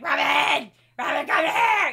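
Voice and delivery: loud, mock-urgent holler for help; imitated screaming. Host imitating Jason summoning her while cooking, mock screaming. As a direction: call out at full volume, imitating someone yelling. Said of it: "screaming at me".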